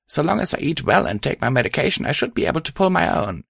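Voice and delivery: a friend gently insisting she'll cope; Patch voice. Acted. Patch continues.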